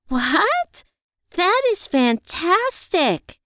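high, drawn-out delighted squeal; excited mock scream. A narrator's reading. Friend hearing of Evie's painting commission, excited squeal. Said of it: "she screamed".

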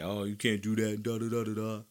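a mild sneering imitation of critics; dismissive mockery. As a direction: brush it off like empty chatter. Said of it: "we wanna blame him and say".

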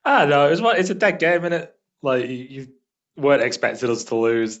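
usual speaking voice

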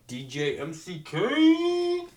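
drawn-out, high, loud stage shout; hype DJ announcer. Pretend. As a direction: announce yourself to the crowd, stretching the last letter. Hyping up his DJ name.

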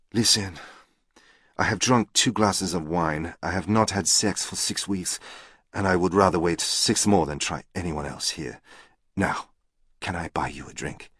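put-on deliberate French-accented English with pauses. A narrator's reading. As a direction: make a frank proposition in a French accent. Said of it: "in an attractive French accent".

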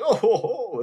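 high, loud hammed-up laugh ho ho; a snooty lady laughing it off, played up; mock posh laugh. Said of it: "It's like".

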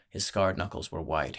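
plain reading voice

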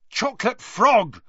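angry yell. A narrator's reading. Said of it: "he yelled angrily".

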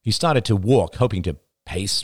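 ordinary delivery, nothing marked

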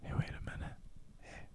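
hushed, voiceless whisper; playful ASMR-style whisper. Pretend. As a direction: whisper close as if sharing a secret. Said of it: "secret A S M R segment".

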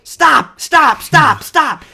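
high, loud repeated shout; mimicked frantic shouting, high and loud; imitating a coach's sideline screaming. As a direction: yell it sharply across the field, mimicking the coach. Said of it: "He's screaming at Justin Fields".

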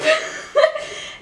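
a high airy burst of laughter; high breathy laugh. Real, not acted. Telling a friend the story, high airy laugh. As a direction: laugh at your own joke, airy and high.

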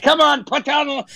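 high-pitched mock Australian, sliding into pirate; botched Australian accent, pirate-like. Radio guest imagining Australian listeners heckling him, botched Australian accent. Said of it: "now I'm sounding like a pirate".

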